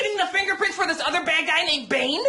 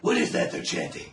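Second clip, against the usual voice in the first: very low, voiceless rasp; Bruce Wayne; 4 dB quieter than usual. Acted. Bruce Wayne asking about the chant, low rasp. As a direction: rumble the question as a worn hero. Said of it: "So Bruce Wayne is like".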